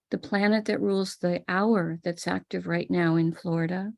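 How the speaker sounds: usual speaking voice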